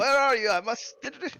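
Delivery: high, loud, bewildered calling; old man hunting for someone; searching senile grandfather. Acted. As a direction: call around the room as a confused old man.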